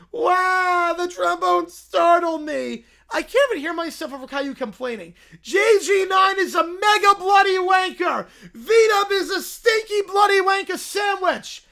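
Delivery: put-on high loud childish yelling; bratty kid screaming. Caillou shouting curses at his co-hosts on air. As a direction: scream the insults like a tantrum.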